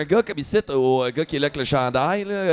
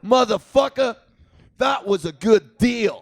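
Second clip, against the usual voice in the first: yelled insult; 6 semitones higher, slower than usual. Retold or imitated. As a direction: shout it across a crowded strip. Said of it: "Il crie". (cri moqueur lancé à travers la rue)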